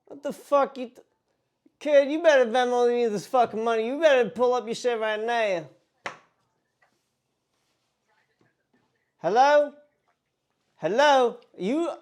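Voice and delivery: mock-angry outburst. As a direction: act outraged at being brushed off. Prank caller escalates his fake threat.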